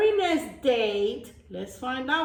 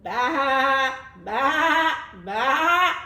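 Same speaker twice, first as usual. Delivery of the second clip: loud drawn-out baa, baa, baa; goat bleats; 6 dB louder, much slower than usual. Acted. Reading to kids, bleating loudly like a goat.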